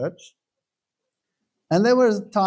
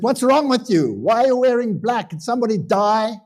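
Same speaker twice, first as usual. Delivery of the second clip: retelling an irritated husband confronting his wife; annoyed husband; 5 semitones higher than usual. Retold or imitated. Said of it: "he looked at her annoyed and he said".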